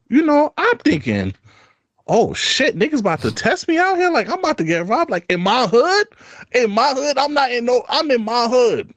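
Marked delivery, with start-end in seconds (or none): 2.1-8.9 higher, rattled inner voice; alarmed thought. Retold or imitated. Thinking to himself about being followed, rattled. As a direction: sound suddenly spooked, asking yourself if you're being robbed. Panicked thought, fearing a robbery.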